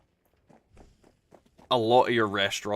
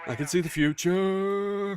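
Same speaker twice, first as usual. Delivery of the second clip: mock mystic voice; 10 semitones higher, slower than usual. Joking about being from future.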